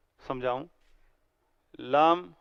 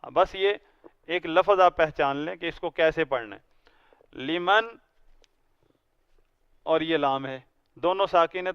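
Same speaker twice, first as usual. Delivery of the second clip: ordinary talk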